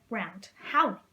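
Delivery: ordinary delivery, nothing marked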